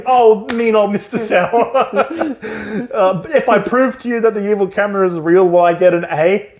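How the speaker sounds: high, whiny put-on kid voice; mocking whiny kid. Ryan Gosling sucking up to his teacher, whiny. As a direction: whine it like a smug schoolboy. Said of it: "Ryan Gosling's like".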